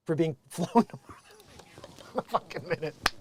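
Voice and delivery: breathless whisper broken by laughter; can barely get the words out for giggling; whispered laughing. Real, not acted. Laughing at the lawsuit headline.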